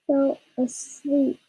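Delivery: ordinary delivery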